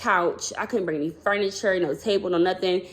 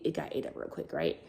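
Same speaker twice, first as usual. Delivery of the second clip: breathy whispered aside, much softer; 6 semitones lower, 12 dB quieter than usual. Real, not acted. Telling viewers the money vanished, in a whisper. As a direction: drop to a hush. Payout gone fast, voice drops.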